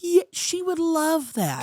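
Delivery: high, slow put-on voice; put-on character voice. Agreeing Sarah would love it, high slow character voice. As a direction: pitch it up and draw it out.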